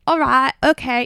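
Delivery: put-on British accent. Mimicking the date accepting free drinks, put-on British accent. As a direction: imitate a fake British accent, exaggerated. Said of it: "he put on a British accent".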